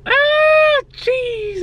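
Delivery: very high excited squeal; hyped squeal. Real, not acted. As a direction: shriek with joy. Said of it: "I'm so hyped".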